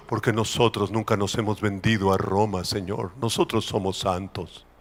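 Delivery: low, hushed mock piety; sanctimonious, voice dropped low and soft; self-righteous praying. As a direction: murmur a smug prayer. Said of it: "los otros judíos que decían".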